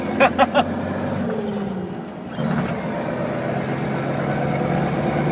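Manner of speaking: high unvoiced giggling; can't stop giggling; giddy wheezing laugh; breathless giggle. Real, not acted. Giggling at the lorry drive.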